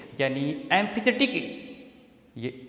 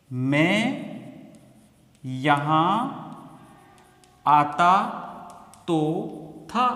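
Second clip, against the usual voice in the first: slow dictation; much slower than usual. Shown as a demonstration. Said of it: "word by word translation".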